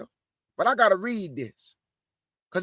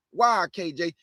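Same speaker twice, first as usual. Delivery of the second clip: usual speaking voice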